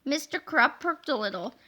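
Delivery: usual speaking voice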